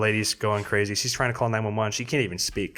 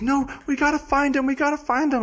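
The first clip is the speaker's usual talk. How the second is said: high, shrill-free mock of mom insisting; high mock voice of a frantic mom; mom's insistence; 4 dB louder than usual. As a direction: imitate mom, high and pleading. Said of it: "She's like".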